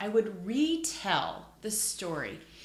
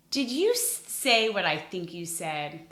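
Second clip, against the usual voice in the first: excited mock surprise; gasp, amazed at the guess; surprised; 6 dB louder than usual. Pretends to hear the answer.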